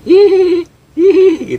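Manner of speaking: very high, loud imitation ghost giggle; mocking child giggle. Ghost child laughs after drawing is burnt, mocking giggle. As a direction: giggle tauntingly like a little kid. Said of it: "suara anak kecil ketawa".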